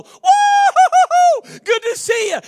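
wife lampooning his hearty greeting, slow and shrieked; wife mimicking her husband's overdone greeting; mocking fake greeting. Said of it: "you gave him the whole".

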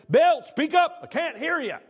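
loud, high, impatient call to speak up; loud demand. Retold or imitated. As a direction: bark it like a president who can't hear. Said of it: "Lyndon Johnson spoke up and said".

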